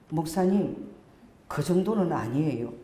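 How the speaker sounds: low, slow, meek objection; hesitant, subdued voice; timid girl's protest. Retold or imitated. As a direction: mildly imitate a reluctant youngster speaking up. Voices silent girl's first timid words.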